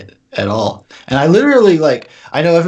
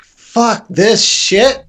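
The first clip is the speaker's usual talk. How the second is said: disgusted outburst; 9 semitones higher, 3 dB louder, much slower than usual. Retold or imitated. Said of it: "I was like".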